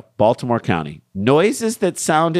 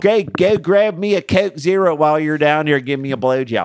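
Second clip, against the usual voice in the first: put-on Philly accent; 4 semitones higher than usual. Host riffing on Philly Zoom porn, in a hammed-up Philly accent. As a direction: lay on a thick Philly accent.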